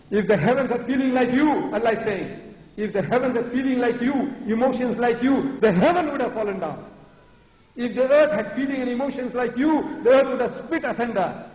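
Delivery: high, ringing indignation; fervent rhetorical build; impassioned preaching. Acted. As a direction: preach it with pitch lifted on each clause. Preacher voicing Allah's indignation.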